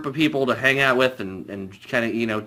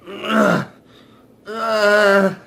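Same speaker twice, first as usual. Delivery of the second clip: angry grunt; 6 semitones higher, 3 dB louder than usual. Retold or imitated. Tommy's angry grunts imitated.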